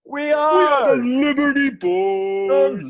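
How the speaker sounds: slow drawn-out stage shout; hyped crowd warm up. Pretend. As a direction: belt the band name out. Band announcing itself on stage.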